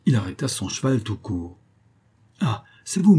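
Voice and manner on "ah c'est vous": exclamation aiguë et forte; exclamation surprise. A narrator's reading. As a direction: feint l'étonnement joyeux. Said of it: "s'écria-t-il". (Friquet s'exclame en voyant l'officier)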